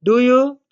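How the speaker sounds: "do you" climbing high at the end; rising question tag. Shown as a demonstration. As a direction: ask "do you" with the voice going up. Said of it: "My voice has gone up".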